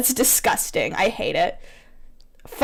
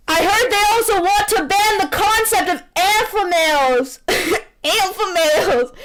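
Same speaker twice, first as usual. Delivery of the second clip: higher, hammed-up excited cry; mock alpha shout; 6 semitones higher than usual. Dante shouting about alpha males, hammed up. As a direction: sell it like a bro. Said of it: "Dante shouts".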